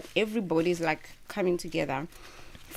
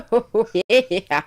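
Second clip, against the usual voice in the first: loud happy laugh; delighted laugh; 7 dB louder than usual. Real, not acted. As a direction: laugh out with pleasure. Reacting to tasting the food.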